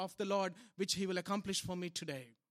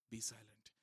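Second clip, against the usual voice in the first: hushed be silent; 10 semitones lower, 11 dB quieter than usual. Shown as a demonstration. Repeated command, soft then loud.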